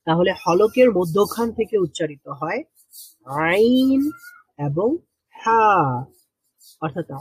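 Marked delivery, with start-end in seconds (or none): 3.2-4.1 আইন squeezed with pressure, a bit higher; pressed throat sound. Shown as a demonstration. Teacher repeating আইন with pressure for students. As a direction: tighten the throat and push out আইন. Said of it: "এক ধরনের চাপও দিতে হয়".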